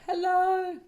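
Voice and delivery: high, loud delighted hello. Real, not acted. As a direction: greet the idea like a happy discovery. Realizing Jupiter is a teacher.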